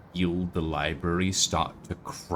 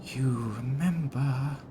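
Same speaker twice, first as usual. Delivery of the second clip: hushed, higher, eerie whispering; sibilant whispers; 5 semitones higher, 6 dB quieter than usual. Acted. Small hologram girl whispering to her visitor, sibilant and hushed. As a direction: whisper it softly, like an echo around the listener. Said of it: "echoing in sibilant whispers around".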